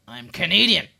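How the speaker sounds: snapping indignantly. Acted. Canadian mistaken for American, loud indignant snap. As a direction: bite their head off. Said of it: "they seem to bite your head off".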